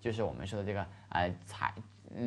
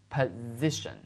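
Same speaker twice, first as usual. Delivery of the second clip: "Position" with the middle syllable stressed and drawn out; stress on second syllable; 4 dB quieter, slower than usual. Shown as a demonstration. Teacher models the word for students to repeat, stress on "si" in "Position". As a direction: lean on the "si" in "Position". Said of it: "注意一下重音在S那个地方".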